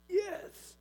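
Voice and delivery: squeaky high gloating yes; a child's triumphant yes; gleeful kid. Retold or imitated.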